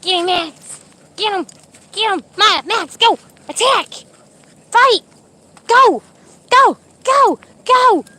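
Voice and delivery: high, building mock attack commands; playful egging on. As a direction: sic the dogs on each other in play, rising to shouts. Egging dogs on to play-fight.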